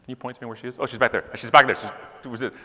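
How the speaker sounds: ordinary talk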